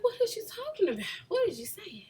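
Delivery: high, acted-out bafflement; high-pitched puzzled listener imitation; baffled questioning. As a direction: ask it way up high, mock confused. Acts listener baffled by Chicago accent.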